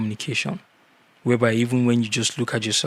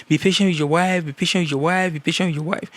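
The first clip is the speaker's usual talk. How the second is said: higher, louder, repeating the advice like everyone nags; hammed-up chorus of advisers, higher and louder; mock nagging advice; 6 semitones higher, 3 dB louder, faster than usual. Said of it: "They tell you".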